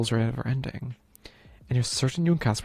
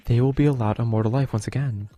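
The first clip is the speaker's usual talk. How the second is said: normal talking voice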